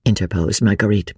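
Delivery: plain reading voice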